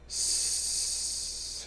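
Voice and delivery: long unvoiced snake hiss; soft drawn-out hiss. A narrator's reading. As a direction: hiss through the teeth. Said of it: "hissed Gollum".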